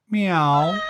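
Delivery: high, loud little meow; cat meow. A narrator's reading. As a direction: mew like a small cat. Cat meows in story.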